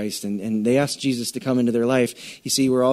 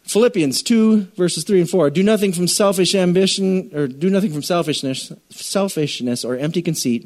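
scripture reading; 3 semitones higher than usual. Acted. Reads scripture with force.

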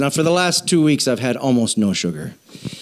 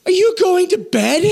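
wife's mock shout. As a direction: call out a surprised question from the other room. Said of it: "she goes".

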